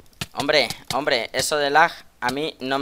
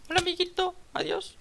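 much higher squeaky cartoon greeting; silly high voice; 7 dB quieter than usual. Shown as a demonstration. YouTuber showing the voice he can use, very high and squeaky. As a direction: squeak it like a tiny cartoon friend. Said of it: "en este tono".

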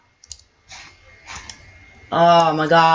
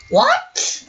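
shocked high what. Real, not acted. Sudden shock at discovery.

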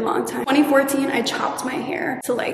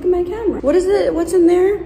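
higher, louder confused question; puzzled, peering into the camera; surprised; 6 semitones higher, 7 dB louder, faster than usual. Real, not acted. Finds no memory card.

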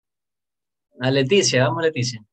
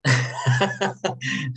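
short real laugh. Real, not acted. Teacher amused by a student's complaint, short laugh. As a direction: laugh briefly at the remark.

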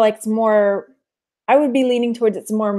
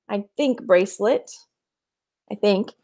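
ordinary delivery

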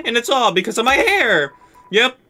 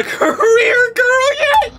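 squealing schoolgirl; slower than usual. Acted.

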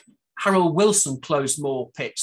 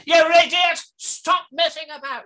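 high, loud angry Thatcher outburst; sudden Thatcher snap; 13 semitones higher, 8 dB louder than usual. Retold or imitated. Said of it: "And then suddenly".

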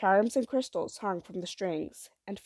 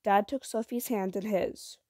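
plain reading voice

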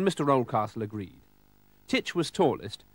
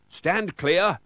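louder, higher warning; warning call; 6 semitones higher, 5 dB louder than usual. A narrator's reading. Oldcastle warns off advancing pirates, raised warning call. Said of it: "warned Mister Oldcastle".

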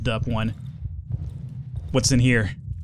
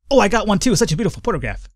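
light, higher excited tweaker; twitchy slurred sniffing addict; 7 semitones higher, faster than usual. Acted. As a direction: gush like a dazed addict. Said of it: "my tweaker voice".

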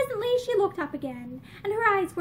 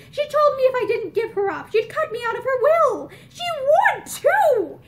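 loud, higher, savage aside; 7 semitones higher, 7 dB louder than usual. A narrator's reading. Ashton telling Mickey about his mother, savage and loud. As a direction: spit it out in bitter anger. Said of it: "in savage parentheses".

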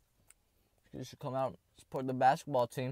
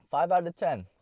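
punchy, raised verdict; loud verdict; 7 semitones higher, 5 dB louder than usual. Shown as a demonstration. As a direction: announce the score.

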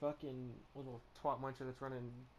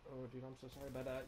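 normal talking voice

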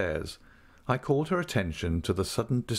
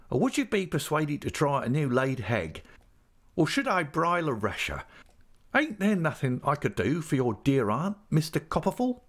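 higher-pitched, uneducated servile voice; fawning landlady; 7 semitones higher than usual. Acted. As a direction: offer help ingratiatingly in a high landlady voice. Landlady fusses, offering food.

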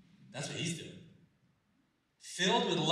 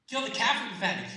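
louder, faster repeat; 6 semitones higher, 6 dB louder, faster than usual. Shown as a demonstration. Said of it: "repeats the line with force".

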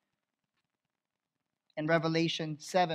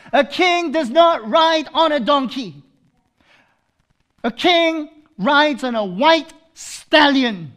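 high, loud preaching cry; fervent pulpit voice; full-force preaching; 7 semitones higher, 13 dB louder than usual. Acted. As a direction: preach it out to the congregation.

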